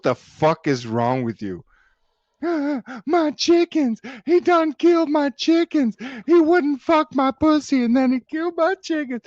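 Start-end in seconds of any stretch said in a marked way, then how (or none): 2.4-3.0 mock gasp. Mocking woman over dead chickens. 3.1-9.2 high, loud shrieking parody of a hysterical woman; shrieking mock woman. Streamer mocks a woman wailing that her chickens were killed.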